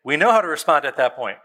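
ordinary talk, nothing marked

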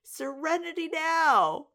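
high, loud, drawn-out mock cry; mock strained calm. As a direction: imitate forced calm bursting out as a slow yell. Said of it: "stay calm and say".

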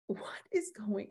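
retold whisper of disbelief; stunned whisper. Couple's stunned reaction retold.

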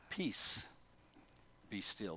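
soft, hushed "Peace, be still"; calm and soft as an old hymn; gentle. Retold or imitated. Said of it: "In the old translations, it says".

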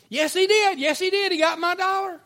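very high, loud accusation; hammed-up kid insisting at full pitch; insistent tattling schoolgirl. Classmate insists, acted quarrel.

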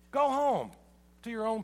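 loud, high call of "Go home", falling at the end; loud closing charge of "Go home"; preached charge. Real, not acted. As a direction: call the congregation home with force.

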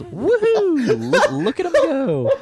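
high, loud laughing cheer; excited whoop. Retold or imitated. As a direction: cheer him on while laughing. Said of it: "Smash says".

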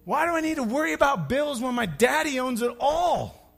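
rally them, almost shouting; emphatic exhortation. Acted. Pastor urging congregation to stop worrying, shouted. Rising exhortation about bills.

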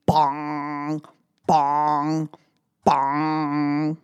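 low, ringing clock bong; clock chime. Pretend. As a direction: ring it out like a big clock.

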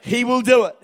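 loud, slow declaration in short phrases; emphatic call-and-response preaching; leading a congregational repeat. Real, not acted. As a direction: proclaim it for the crowd to echo. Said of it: "say this after me".

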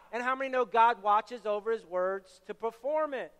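ordinary talk

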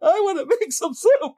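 high, hammed-up crying nurse; a nurse sobbing over soup, hammed up; mock sob. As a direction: wail it like a weepy nurse. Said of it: "she's like".

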